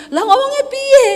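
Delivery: high, loud mock protest; exasperated retort. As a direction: protest loudly, voice jumping up. Exasperated retort to husband's scolding. (membantah kesal dengan nada tinggi)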